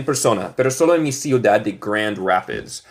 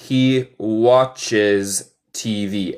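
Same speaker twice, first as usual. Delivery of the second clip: very slow, careful "he watches T V"; slow model sentence; much slower than usual. Shown as a demonstration. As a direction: spell it out slowly. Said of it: "So se dice".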